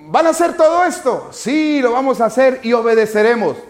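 high, loud question and answer; loud acted proclamation. Teacher playing the people pledging obedience, loud and high. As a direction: call it out as if to a whole crowd.

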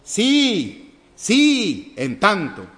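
"Sí, sí" shouted high and loud; loud insistent "sí, sí, en tanto" with falling "tanto"; emphatic yes. Shown as a demonstration. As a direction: hammer each "sí" as a firm yes. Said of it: "sin acento es un sí condicional".

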